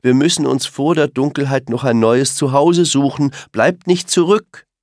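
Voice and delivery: hoch und laut, drängend gerufen; lauter Ruf. Acted. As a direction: Kinder zur Eile rufen. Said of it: "ruft Mama Reh". (Mama Reh ruft die Kinder laut zum Aufbruch)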